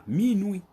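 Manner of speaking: "Minuit" said slowly and clearly as mi-nwi; teacher models "Minuit" for the class to copy; careful model pronunciation. Shown as a demonstration. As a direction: pronounce "Minuit" crisply, stress on nuit. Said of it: "Écoutez bien, accent".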